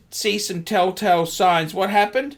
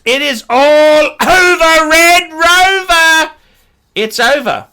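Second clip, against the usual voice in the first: high, loud and slow, like a taunting playground chant; sarcastic singsong triumph; gloating singsong; 7 semitones higher, 6 dB louder than usual. Real, not acted. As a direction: drag it out to rub it in. Gloating that Omega collapsed.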